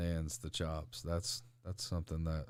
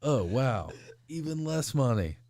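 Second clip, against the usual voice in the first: dad's sarcastic jab, pitched up and loud; lightly voiced father, dry and mocking; 7 semitones higher, 9 dB louder, slower than usual. Son voicing his dad's sarcastic reaction, mock high and loud. Dad mocking wrestling career choice.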